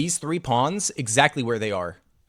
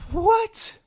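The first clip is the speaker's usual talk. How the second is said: shocked squeal. Real, not acted. Shocked by opponent's move.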